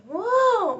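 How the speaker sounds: sudden high loud yelp; involuntary squeal; high loud tic yelp. Real, not acted. Upset retelling the visit, a loud high tic yelp. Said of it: "I'm agitating my tics".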